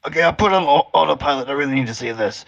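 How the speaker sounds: loud, eager outburst; real excitement. Real, not acted. Player abandoning the helm to see the dinosaur, loud excitement.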